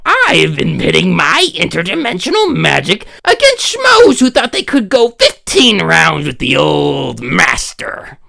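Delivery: usual speaking voice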